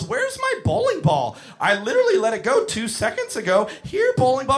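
put-on high, dopey child calling; silly kid voice. Kid with head in ball return calling for his ball, dopey. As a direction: call for the ball like a clueless kid. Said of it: "And he goes".

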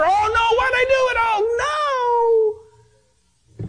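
high, drawn-out wail of regret; mock regretful wail. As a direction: wail it like a hammed-up panicking sinner. Acts out regretful sinner after the moment.

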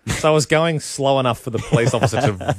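usual speaking voice

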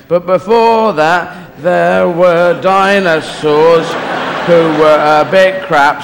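slow, drawn-out proclamation; scribe intoning scripture. Acted. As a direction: intone it grandly, stretching each word.

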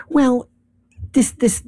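ordinary delivery

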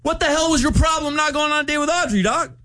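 high, indignant mock outburst; put-on tough-guy challenge; mock tough confrontation. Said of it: "sound like I was tough".

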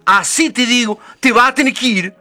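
high, loud scolding; angry Madre Nieve. Acted. Madre Nieve angrily sends the lazy girl away, high and loud.

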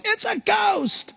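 high, frightened cry of ghost; panicked disciples crying out at a ghost; terrified shout. Retold or imitated. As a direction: yell it like you've seen a spirit. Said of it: "That's why they shouted".

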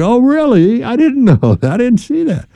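high, feigned surprise, playing dumb; hammed-up fake surprise about the dishwasher; mock innocent surprise. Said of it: "I'd be".

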